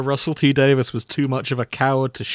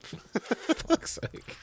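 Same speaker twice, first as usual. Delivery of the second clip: exasperated whisper; 11 semitones higher, 12 dB quieter, much slower than usual. Real, not acted. Exasperated at the joke.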